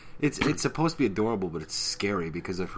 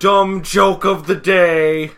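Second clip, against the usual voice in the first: loud, high, drawn-out announcement; mock announcer; 9 semitones higher, 15 dB louder, much slower than usual. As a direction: proclaim it slowly like a segment title. Mock announcing a bad joke.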